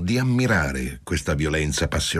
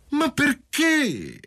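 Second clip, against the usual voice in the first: much higher, very slow dismayed cry; much slower than usual. A narrator's reading. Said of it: "ella gridò con una specie di costernazione".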